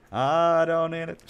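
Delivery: mock-grand slow "I don't need it"; drawn-out defiant declaration. Shown as a demonstration. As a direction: belt out "I don't need it" slowly like a proud boast.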